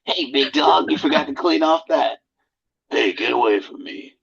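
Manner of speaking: lightly voiced tough guy; a hint of his own gruff gym voice; self impersonation. Mimicking himself telling gym guys off, lightly voiced. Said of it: "That's my impersonation of myself".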